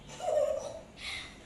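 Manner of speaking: high unvoiced girlish giggle; a light princess giggle. A narrator's reading. Princess laughing at Puss in boots, giggling. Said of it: "the princess just giggled".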